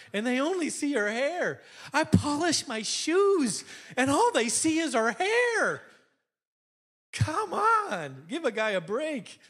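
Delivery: high-pitched comic indignation; hammed-up, drawn-out griping; mock exasperation. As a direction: plead your case in mock outrage, pitch soaring. Mock complaint about hair remarks.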